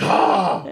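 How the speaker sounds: high mock gasp of fright; startled scream. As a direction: jump as if caught by a scare. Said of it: "they just jumped".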